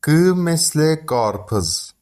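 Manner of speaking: قارپز said slowly with a hard گ; slow careful قارپز with a clear گ; Turkish example word. Shown as a demonstration. (قارپز آهسته با گ روشن)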